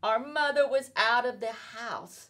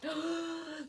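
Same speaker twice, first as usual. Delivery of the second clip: gasp; 9 semitones higher, 8 dB quieter than usual. Acted. Reader reacts to cat's trick.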